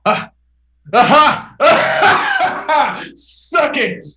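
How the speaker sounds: loud, high gloating cheer; triumphant shout. Acted. Castaway Helmsworth crowing at his rescuers, loud and triumphant. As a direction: crow with triumph at being rescued. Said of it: "He's like".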